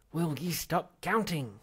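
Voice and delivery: loud, slow snarled demand; snarling. Acted. Zaphod snarling at Ford to stop the countdown. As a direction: bite off each word in irritation. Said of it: "snarled Zaphod".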